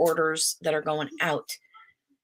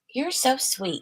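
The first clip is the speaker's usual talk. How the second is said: higher, cooing praise; 7 semitones higher, slower than usual. Real, not acted. Mother praises her baby girl, high cooing.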